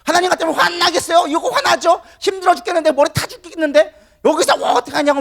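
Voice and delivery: high, loud heated protest; angry Jonah. Acted. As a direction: rant indignantly at the top of the range. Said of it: "막 화를 내는 것이 옳다라고".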